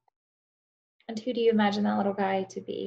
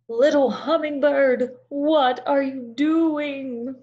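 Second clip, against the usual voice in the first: slow, higher, louder bear calling out; Big Bear; 6 semitones higher, 7 dB louder, much slower than usual. Acted. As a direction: call across like a big puzzled bear. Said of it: "Big Bear said".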